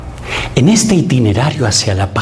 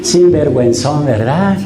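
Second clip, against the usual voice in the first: mocking scold; 5 semitones higher than usual. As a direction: jeer at the sinner like a smug judge. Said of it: "No le dice".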